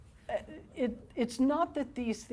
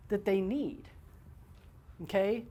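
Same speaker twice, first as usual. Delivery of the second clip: ordinary talk, nothing marked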